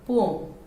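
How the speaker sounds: पोम in one syllable, like "pome"; merged poem. Shown as a demonstration. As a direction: say पोम without a separate e. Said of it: "इसको कैसे बोलते हैं?".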